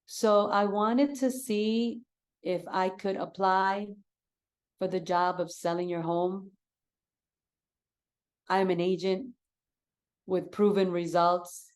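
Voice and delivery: slow, halting, subdued agent pitch; slow, low-energy pitch. Shown as a demonstration. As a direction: deliver the pitch in small, unhurried pieces. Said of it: "I'm speaking to them this way".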